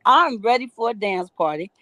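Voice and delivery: normal talking voice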